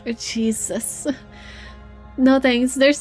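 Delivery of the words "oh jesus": normal talking voice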